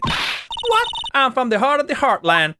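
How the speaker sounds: high, loud Southern twang calling out; a brash Southern gal hollering at him; loud, high Southern drawl; southern accent. Acted. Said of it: "that southern accent".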